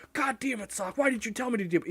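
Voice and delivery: high, hammed-up blaming whine; mock tantrum. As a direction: pitch up and fake the outrage. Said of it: "I'll be like".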